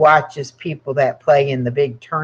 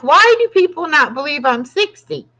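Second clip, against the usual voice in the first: exasperated; 9 semitones higher, 3 dB louder than usual. Retold or imitated. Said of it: "I'm like".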